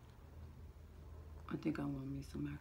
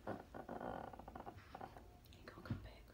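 whisper; 12 dB quieter, much faster than usual. Real, not acted. Whispering to baby.

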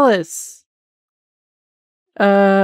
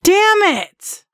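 frustrated outburst; 11 semitones higher than usual. Real, not acted. Enemy kills the girl.

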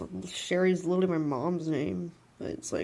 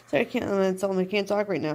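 normal talking voice

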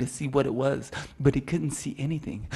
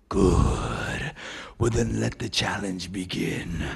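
sinister elk voice; 7 semitones lower, slower than usual. Acted.

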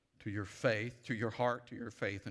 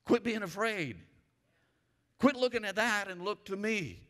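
higher, louder preaching; full-force preaching; 10 semitones higher, 5 dB louder than usual. Acted. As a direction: preach it out to the room.

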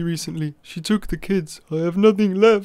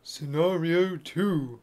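ASMR title voice; 4 semitones lower, 4 dB quieter, much slower than usual. Acted.

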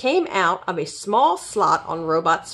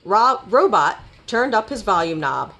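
plain reading voice

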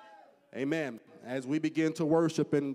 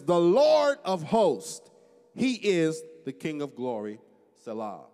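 slow, emphatic pulpit proclamation; scripture declamation; 4 semitones higher, 8 dB louder, slower than usual. Acted. Preacher answering the psalm's question, declaimed.

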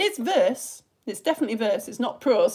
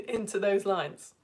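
ordinary delivery

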